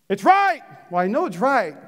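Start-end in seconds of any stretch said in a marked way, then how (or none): 0.1-0.6 mock congregant. Preacher voicing a congregant.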